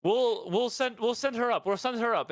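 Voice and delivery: very high, placating villager; townsfolk voice. Acted. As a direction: promise it in a high, put-on voice. Townsfolk answer Marjorie's seeker.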